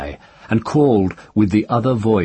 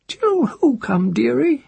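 whispered crone question; slower than usual. A narrator's reading. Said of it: "she whispered".

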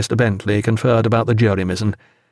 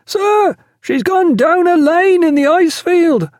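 high, drawn-out shout broken by laughter; lookout's hail; 4 dB louder than usual. A narrator's reading. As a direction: call down gleefully from the masthead. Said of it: "hailed the lookout".

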